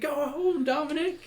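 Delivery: high-pitched worried wail, imitating a fan; mock fearful cry. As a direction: imitate a frightened heckler, voice pushed way up. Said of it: "fear, like she was worried for him".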